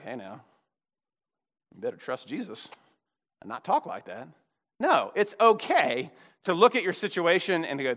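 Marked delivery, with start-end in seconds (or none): 0.0-4.3 low, soft chiding; mock pious reproof. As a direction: gently tut at them, voice held down. Mimicking pious Christians' gentle reproof.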